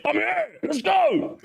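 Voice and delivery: playful hunter-gatherer war cry, jumping way up high; mock battle cry. Feeling like a hunter-gatherer from ancient times, mock battle cry.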